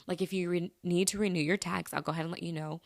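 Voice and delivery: usual speaking voice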